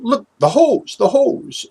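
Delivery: high, loud cry of alarm from dad; sudden shocked call pointing something out; father spotting the garden hose fed through the window, high startled shout. Retold or imitated.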